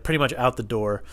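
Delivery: usual speaking voice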